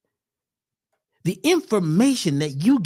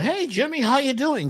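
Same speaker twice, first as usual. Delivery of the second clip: fake cheery greeting; 7 semitones higher than usual. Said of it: "you jump right into".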